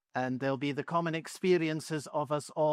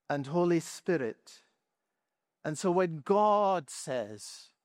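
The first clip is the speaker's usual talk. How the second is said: normal talking voice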